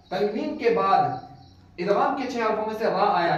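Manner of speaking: normal talking voice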